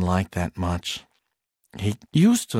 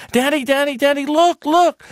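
put-on high, loud little boy calling out; excited child calling; 6 dB louder than usual. Son waking his father to look, high and loud. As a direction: shout for dad like a thrilled kid.

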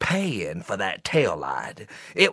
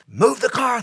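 panicked order; 12 semitones higher than usual. Retold or imitated. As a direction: yell at her to move the car. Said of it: "Harold said".

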